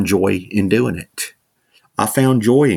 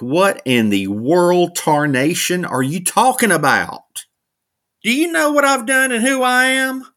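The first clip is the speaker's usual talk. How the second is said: incredulous; 9 semitones higher than usual. Retold or imitated. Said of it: "I probably looked at him like".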